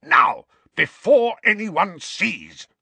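loud, high, slow furious snarl; urgent snarl. A narrator's reading. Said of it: "Uncle Vernon snarled into Harry's ear".

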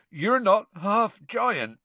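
slow, croaky choked Hagrid. Acted. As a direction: croak it out, close to tears. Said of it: "said Hagrid croakily".

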